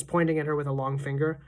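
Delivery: ordinary delivery, nothing marked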